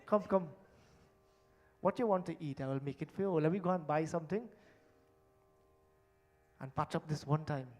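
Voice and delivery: soft making-up plea to his wife; husband sweetly patching up the quarrel; apologetic husband. Retold or imitated. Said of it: "he said".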